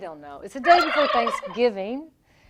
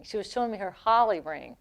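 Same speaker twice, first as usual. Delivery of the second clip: usual speaking voice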